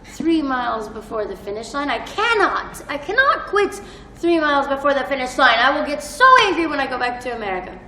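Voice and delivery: high, loud, pleading to Jesus; frantic mile-twenty-three prayer to Jesus; desperate plea. Retold or imitated. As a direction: push through it, begging not to quit. Praying mid-marathon, pleading with Jesus.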